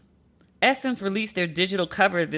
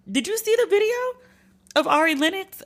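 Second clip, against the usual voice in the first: excited; 12 semitones higher than usual. Real, not acted. Excited question to listeners.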